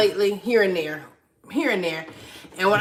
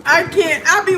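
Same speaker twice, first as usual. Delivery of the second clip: laughing; 9 semitones higher, 3 dB louder, slower than usual. Real, not acted. Giggling, unable to continue.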